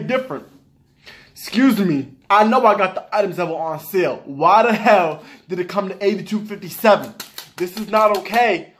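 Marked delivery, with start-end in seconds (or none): none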